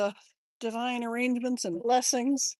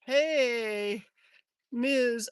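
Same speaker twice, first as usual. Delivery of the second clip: ordinary delivery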